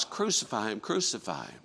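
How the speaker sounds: usual speaking voice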